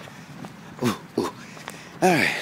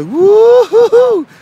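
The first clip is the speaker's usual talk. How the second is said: whooping; 12 dB louder, much slower than usual. Retold or imitated. Rowdy teammates on the sideline.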